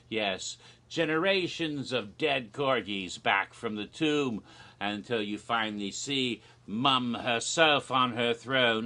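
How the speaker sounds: ordinary delivery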